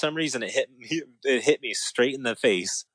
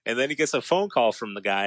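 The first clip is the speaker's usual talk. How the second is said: ordinary delivery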